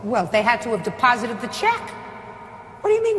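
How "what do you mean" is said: high, incredulous demand; disbelieving, pushing for a straight answer; exasperated. Real, not acted. Judge exasperated at defendant.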